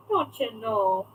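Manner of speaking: slow, drawn-out Minnesota vowels; mock Minnesota accent. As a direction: put on a Minnesotan lilt, stretched out. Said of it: "she had their accent".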